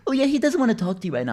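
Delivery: high, quick put-on mother voice; mock mom. Son mimics mom's excuse for brother, high quick voice. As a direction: rattle it off high, imitating a mother. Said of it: "And my mom's like".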